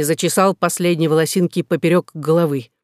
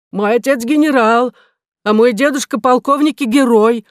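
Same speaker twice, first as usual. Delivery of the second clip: exclaiming; 6 semitones higher, 5 dB louder than usual. A narrator's reading. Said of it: "воскликнул министр".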